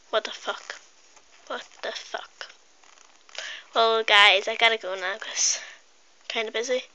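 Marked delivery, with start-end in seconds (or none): 0.1-0.8 breathy unvoiced mutter; hushed disbelieving aside. Real, not acted. Muttered aside after retelling. 1.5-2.5 much softer full whisper; whispered disbelief. Real, not acted. Repeated even quieter.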